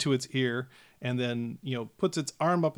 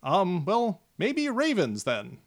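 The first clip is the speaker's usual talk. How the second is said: timid gnome reply; 5 semitones higher, slower than usual. Acted. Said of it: "and says".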